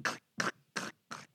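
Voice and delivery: voiceless tongue clicks for heels; imitated heel clicks. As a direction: click softly like heels on wood. Imitating high heels on wood.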